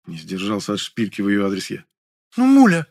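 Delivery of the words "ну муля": high, loud, pouty whine; mock-feminine indignant wail; pouting indignation. A narrator's reading. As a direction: pout and protest in a high voice. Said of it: "Возмутилась Лёля и надула губки".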